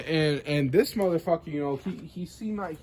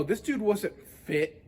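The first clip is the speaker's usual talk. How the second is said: ordinary talk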